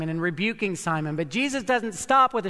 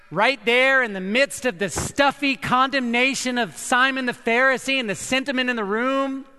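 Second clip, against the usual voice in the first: normal talking voice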